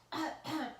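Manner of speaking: hammed-up throat clear; fake cough. A narrator's reading. Villain faking illness on the phone, fake cough. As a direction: cough falsely for effect. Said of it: "in a very fake way".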